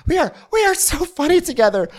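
high, eager gushing; mock enthusiasm. Rinna telling Garcelle they are great, mock gushing. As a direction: gush it in a high put-on voice.

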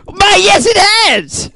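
very high, hammy hero voice; the Guardian. Acted. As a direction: answer the nemesis in a squealing theatrical pitch. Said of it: "the Guardian character".

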